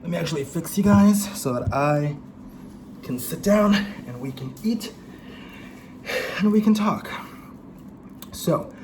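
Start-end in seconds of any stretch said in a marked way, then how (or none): 1.7-8.7 breathless from walking; a bit winded; out of breath. Real, not acted. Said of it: "I'm out of breath".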